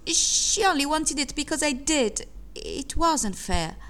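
high, plaintive protest; child's whine of unfairness; aggrieved child. A narrator's reading. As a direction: complain high, slowing on the last words. Child complains to father.

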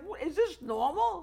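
high-pitched incredulous question; retold disbelief. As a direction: ask it in baffled astonishment, pitched right up. Retells shocked reaction at game.

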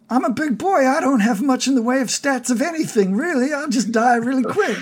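high-pitched, rambling self-deprecating plea; hapless Harry character. Acted. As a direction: talk your way out, pitched well up. Voices Harry objecting.

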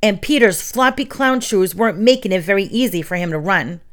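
ordinary delivery, nothing marked

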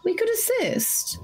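gentle halfling coaxing. Acted. Halfling coaxing frightened NPC.

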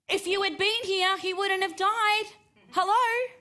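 mock the sister's frustrated outcry, ending in a pointed "Hello?"; exasperated reproach. Preacher imitating Mary reproaching Jesus for coming late, high exasperated outcry.

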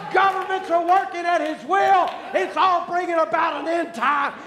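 preacher rousing the church, loud and high; preaching at full force. Acted. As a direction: drive it home to the congregation.

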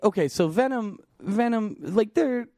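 excited high voice. Real, not acted. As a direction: jump up high, excited to explain. Sudden excited high pitch rush.

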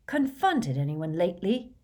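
plain reading voice

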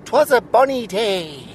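slow, high sing-song Welsh lilt; lilting Welsh storyteller; Welsh accent. Acted. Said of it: "Um, Welsh".

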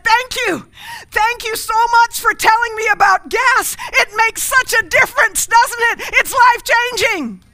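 high, loud, gushing gratitude; man rushing back to the pastor, thrilled and loud; overjoyed thanks. Acted. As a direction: shout your thanks like it changed your life. Grateful man returns in joke.

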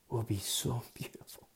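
near-whisper, soft and reverent; hushed awe. Real, not acted. Preacher telling listeners of beauty after tribulation, hushed. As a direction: let it sink to a breath of a whisper. Moved while describing anguished prayer.

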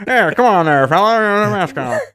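high, loud 1950s narrator voice; a brassy old newsreel announcer; old-timey voice. Retold or imitated. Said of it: "Old-timey voice".

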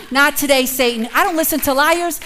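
preacher defying the devil; bold rebuke. Acted. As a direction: tell Satan off. Rebuking Satan.

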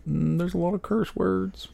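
high, soft and slow; musing inner voice. Retold or imitated. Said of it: "I'm like".

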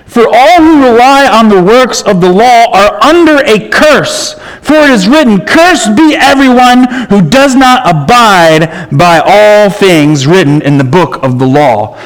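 emphatic scripture reading. Shown as a demonstration. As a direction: proclaim the curse verse with force. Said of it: "He says in verse ten".

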